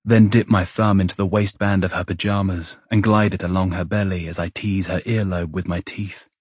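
plain reading voice

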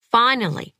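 plain reading voice

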